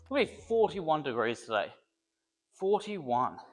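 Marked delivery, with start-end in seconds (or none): none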